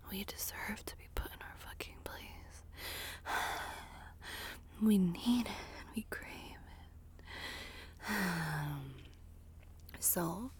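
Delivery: breathy close-mic ASMR whisper; intimate whisper. Acted. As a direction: whisper it right into the listener's ear.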